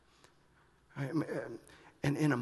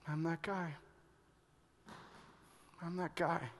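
quiet realization; 9 dB quieter than usual. Retold or imitated.

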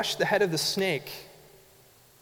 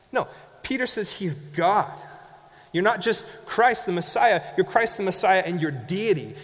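rising preaching; 3 semitones higher than usual. Acted. Preaching builds in force.

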